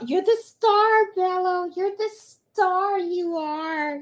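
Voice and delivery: high, gushing coo; doting pet talk. Real, not acted. Telling Mello he's the star, doting pet talk. Gushing praise to her dog.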